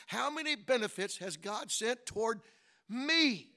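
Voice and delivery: "me" set apart after a pause, high and loud; stress on me. Shown as a demonstration. Preacher showing the congregation the verse is personal, stress on "me". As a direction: land hard on the final "me". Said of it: "put some emphasis on the last word, me".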